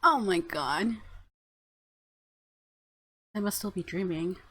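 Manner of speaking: ordinary delivery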